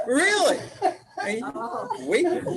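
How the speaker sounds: laughing surprise; amused surprise. Real, not acted. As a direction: toss it up in disbelief. Amused disbelief at crying.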